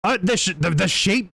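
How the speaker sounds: normal talking voice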